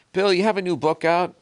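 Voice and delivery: mock talk show host. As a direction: play the eager TV host asking about the book.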